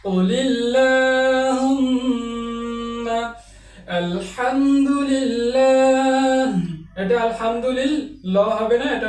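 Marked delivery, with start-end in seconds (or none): 0.1-3.2 কুলিল্লাহুম্মা chanted very slowly with a light lam; thin lam. Shown as a demonstration. Quran teacher chanting কুলিল্লাহুম্মা slowly with a thin lam. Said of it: "পাতলা করে উচ্চারণ করতে হবে". 4.0-6.5 আলহামদুলিল্লাহ chanted slowly with a light lam; thin lam. Shown as a demonstration. Quran teacher chanting আলহামদুলিল্লাহ slowly with a thin lam. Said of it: "এভাবে উচ্চারণ করতে হয়".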